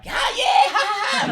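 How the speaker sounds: whooping imitation. Comic retells a wild hot lap with Bron Lewis, whooping imitation. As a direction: whoop and cackle like a thrilled reckless driver.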